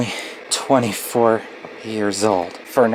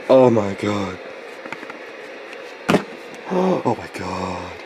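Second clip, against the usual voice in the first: awed gasp; 3 semitones lower, slower than usual. Real, not acted. Gasps at childhood decorations.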